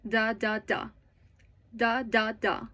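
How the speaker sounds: "da, da, da" as separate spoken beats; evenly spaced "da" syllables in place of words; rhythm demo. Shown as a demonstration. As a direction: tap out the sentence rhythm on "da". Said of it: "The rhythm sounds like".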